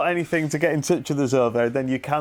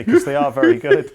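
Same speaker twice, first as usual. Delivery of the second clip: laughing; 7 dB louder than usual. Real, not acted. Co-host defending the little voices that annoy Claire, laughing. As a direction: voice rising high with laughter.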